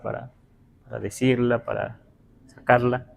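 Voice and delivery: normal talking voice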